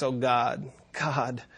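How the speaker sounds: soft trembling whisper, fading away; a quiet trembling plea to God. A narrator's reading. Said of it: "whispered with a trembling voice".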